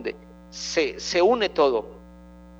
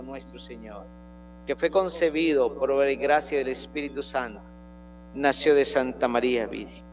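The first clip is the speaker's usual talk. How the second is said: steady, solemn prayer recitation; reciting the Creed. Acted.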